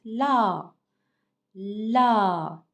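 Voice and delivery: "La" with a loud, long-held vowel; pronunciation demo. Shown as a demonstration. Teacher shows pupils L with A, "La" drawn out and loud. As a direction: stretch "La" out firmly for learners. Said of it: "comment je prononce?".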